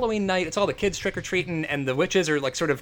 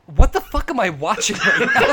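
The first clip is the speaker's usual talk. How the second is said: loud, laughing disbelief; laughing outburst; 4 semitones higher, 8 dB louder than usual. Real, not acted. Podcaster laughing at a horny movie, loud and incredulous. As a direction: shout it out through laughter.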